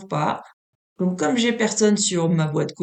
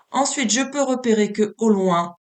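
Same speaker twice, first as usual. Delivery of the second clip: normal talking voice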